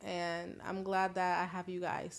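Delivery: ordinary talk, nothing marked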